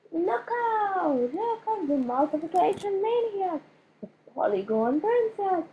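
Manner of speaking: high, mocking schoolkid voice, taunting; teasing classmate. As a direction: chant the nicknames like a showing-off kid. Kid classmate teasing Judy.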